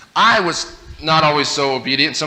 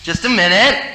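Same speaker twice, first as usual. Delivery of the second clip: put-on kid reply; 7 semitones higher than usual. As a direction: answer while still busy playing.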